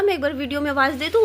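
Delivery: ordinary talk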